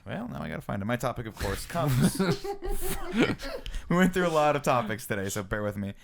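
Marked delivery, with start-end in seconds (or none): 2.2-3.8 high-pitched burst of laughter; helpless high giggle; real laugh. Real, not acted. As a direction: crack up mid-introduction. Cracks up at own words.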